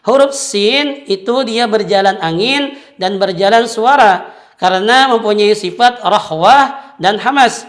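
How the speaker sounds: ordinary delivery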